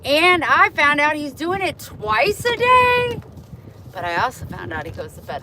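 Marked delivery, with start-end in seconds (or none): 0.1-3.2 higher, louder and slow; indignant. Real, not acted. Telling viewers about the neighbor, indignant. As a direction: stress the outrage.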